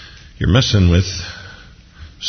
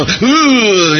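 high, drawn-out "ooh" from on high; hammed-up menacing howl; exaggerated booming wail; mock wrathful wail; 13 semitones higher, 4 dB louder than usual. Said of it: "I don't think this is angry".

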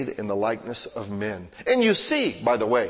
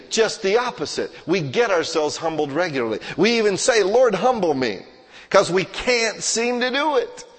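fiery preaching; 6 semitones higher, 5 dB louder than usual. Acted. The pastor drives humility home to the congregation with full-force preaching. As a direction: press each point home from the pulpit.

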